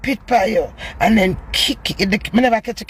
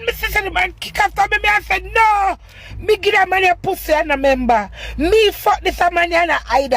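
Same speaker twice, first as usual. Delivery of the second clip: high-pitched, worked-up telling; rising to a shout at the end; heated retelling; 10 semitones higher than usual. Retold or imitated. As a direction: pitch right up as the fight comes back. Retelling the fight, getting heated.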